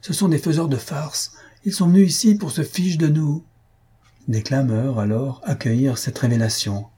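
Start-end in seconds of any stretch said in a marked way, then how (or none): 0.0-3.4 high, heated accusation, partly breathy; angry denunciation. Acted. Maroquin denouncing the pranksters to the audience, angry. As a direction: expose the pranksters to the whole hall. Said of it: "hurle le Maroquin".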